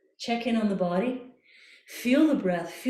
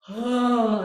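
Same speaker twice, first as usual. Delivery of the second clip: yawny sigh. Shown as a demonstration. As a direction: open airy sigh on ah. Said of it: "On a sigh now".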